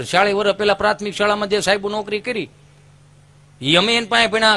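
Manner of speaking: ordinary talk, nothing marked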